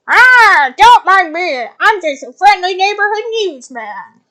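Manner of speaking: high, loud, over-the-top newsman; booming cheesy TV reporter; hyper reporter voice. Acted. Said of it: "hyper realism reporter voice".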